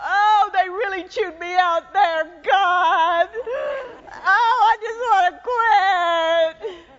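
a discouraged believer whining to God, slow and drawn out; hammed-up complaint of someone ready to quit; mock whine. Preacher playing a beaten-down believer, mock whining.

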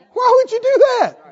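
high, loud incredulous question; exasperated challenge. Real, not acted. Preacher challenging those who talk themselves down, loud exasperation.

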